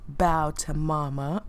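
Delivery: a mock-deep domineering tall woman; deep mama voice. Streamer pretending to be a towering woman: mock deep voice. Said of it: "gotta make my voice deep".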